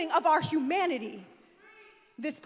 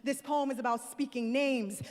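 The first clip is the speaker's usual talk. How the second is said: ordinary talk, nothing marked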